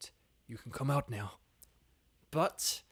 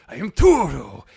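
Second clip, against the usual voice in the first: put-on loud, hammy Spanish accent; Spanish Turo voice; 15 dB louder than usual. Streamer pitches a comic panel of Turo, loud mock Spanish accent. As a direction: boom it out as a Spanish swashbuckler. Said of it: "like, kinda Turo close up saying".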